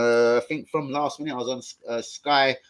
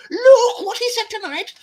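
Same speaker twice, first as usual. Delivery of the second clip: mocking quote; 10 dB louder, slower than usual. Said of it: "quoting a mocking tweet".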